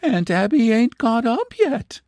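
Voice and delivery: high, wide-eyed innocent voice; childlike innocent voice. Acted. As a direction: sound puzzled and helpless. Luella innocent surprise.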